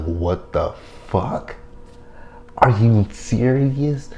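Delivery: slow, drawn-out disbelief rising high; slow stunned mock outrage. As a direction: stretch it out in mock shock. Retold disbelief at reveal.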